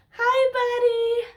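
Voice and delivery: high, slow, tender; tender tearful greeting. Real, not acted. Tearfully addressing Elijah.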